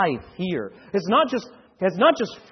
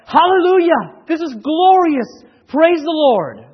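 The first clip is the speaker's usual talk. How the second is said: high, loud shout of praise; joyful cry; 9 semitones higher, 9 dB louder, slower than usual. Acted. Saints crying out before the Lord, ecstatic shout.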